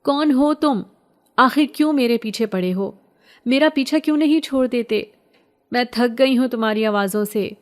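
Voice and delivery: high, loud desperate cries; screaming at the voice. Acted. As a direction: scream it out, begging to be left alone. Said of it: "زور زور سے چیخنے لگی".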